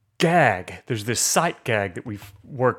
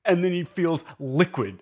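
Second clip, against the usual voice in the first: usual speaking voice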